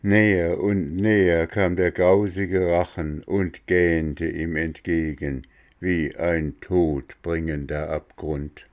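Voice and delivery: ordinary delivery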